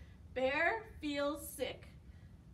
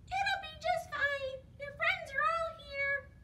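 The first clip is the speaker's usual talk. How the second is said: very high tiny mouse voice; whispering mouse; 12 semitones higher than usual. A narrator's reading. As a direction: squeak comfort into a friend's ear. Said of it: "he whispers in his ear".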